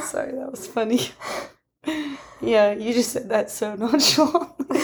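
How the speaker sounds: real giggle breaking into the words; amused, laughter-laced apology and remark; laughing. Real, not acted. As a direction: talk through helpless amusement. Cracking up at partner's remark.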